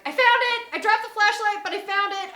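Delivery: high, loud shout easing slightly; calling back. Retold or imitated. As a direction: shout the news back across the woods. Friend calls back from woods.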